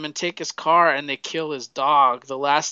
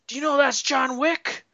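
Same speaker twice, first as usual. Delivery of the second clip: much higher, put-on voice, alarmed; voicing a gangster; 11 semitones higher than usual. Leguizamo's character warning the kid, higher put-on voice. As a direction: squeak it out like a scared mobster. Gangster warns about John Wick.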